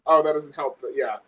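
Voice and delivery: normal talking voice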